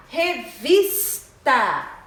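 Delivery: "Revista" slow, with VIS loud and stretched; syllable by syllable "Revista", strong on VIS; stressed syllable. Shown as a demonstration. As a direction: hit the middle syllable of "Revista" hard. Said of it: "Vis é a sílaba tônica".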